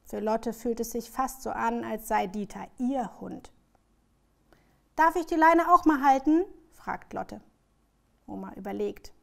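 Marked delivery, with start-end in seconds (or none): 4.9-6.5 higher, louder pleading question; child's eager question. A narrator's reading. Lotte asks grandma to hold the leash, eager question. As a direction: ask eagerly, pitched up.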